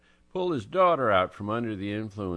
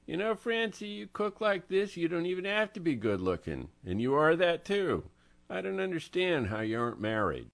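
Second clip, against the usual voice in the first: Angelo's voice; 11 semitones higher than usual. Acted. Angelo complimenting Francie.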